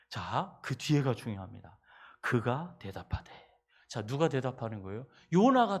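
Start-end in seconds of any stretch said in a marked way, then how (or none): none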